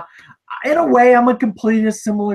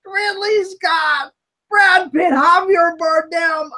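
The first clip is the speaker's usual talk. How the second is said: higher, slow shouted list of names; outraged roll call; 7 semitones higher, slower than usual. Acted. Host reading out the cast to the guessing co-hosts, in a slow, high shout. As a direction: bellow each name like an indictment.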